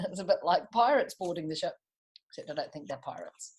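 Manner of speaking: plain reading voice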